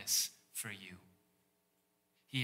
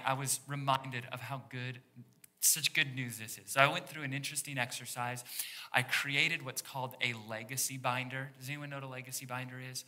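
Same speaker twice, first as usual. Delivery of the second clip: usual speaking voice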